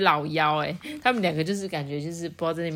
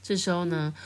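ordinary delivery, nothing marked

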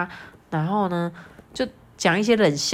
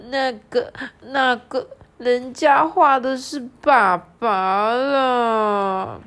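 put-on high, very slow, hesitant little girl; shy Lala; 6 semitones higher, 4 dB louder, much slower than usual. Lala telling dad whom she drew, slow and shy. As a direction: mumble it out shyly. Reading Lala's line.

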